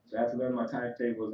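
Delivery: ordinary talk, nothing marked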